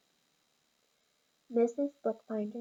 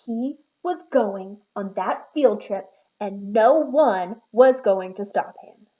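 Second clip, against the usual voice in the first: determined anger; 15 dB louder, slower than usual. A narrator's reading. Angry firefly refuses to give up.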